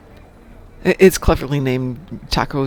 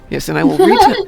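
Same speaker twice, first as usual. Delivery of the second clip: laughing; 8 dB louder than usual. Real, not acted. Laughing while starting joke.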